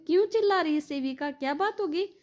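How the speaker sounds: high, brisk imitated call; calling to ask. Mother asks caretaker about the yelling, high calling question. Calls to caretaker from other room.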